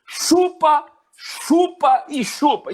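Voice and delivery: grito agudo e alto, alongando as palavras; desabafo provocador. Retold or imitated. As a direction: reencene a provocação esticando cada grito bem alto. (grito de desabafo provocador)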